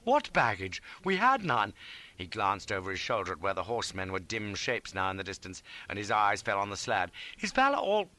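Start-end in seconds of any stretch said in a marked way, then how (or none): 0.0-1.7 raised, puzzled protest; bewildered cry. A narrator's reading. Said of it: "and then cries". 7.4-8.1 high, anxious question; dawning horror. A narrator's reading. East realising the woman is gone.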